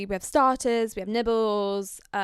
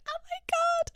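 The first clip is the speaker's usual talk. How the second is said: high, squeaky gasp of disbelief; a shocked squeal; stunned oh my god. Retold or imitated. Shocked reaction to the business card.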